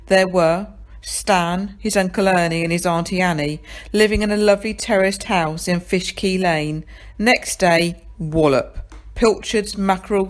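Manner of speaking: ordinary delivery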